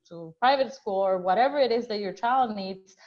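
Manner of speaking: ordinary talk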